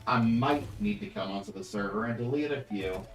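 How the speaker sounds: normal talking voice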